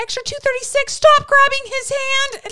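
very high, loud laughing mock shout; mock director yelling. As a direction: shriek at the extra through laughter. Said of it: "and they're like".

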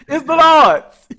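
loud, high, drawn-out testifying; mock church outcry. Host plays church member answering Yolanda, loud high outcry. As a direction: cry it out like a fired-up congregant. Acted church congregant crying out.

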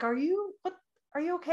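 a hint of a concerned husband asking; puzzled husband. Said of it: "he's like".

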